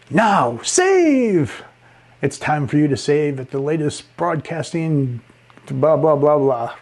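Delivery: hammed-up commercial announcer. Shown as a demonstration. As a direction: jump up on "Now save!" like a pushy radio ad. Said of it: "go up in pitch ... with different keywords".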